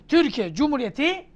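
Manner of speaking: ordinary talk, nothing marked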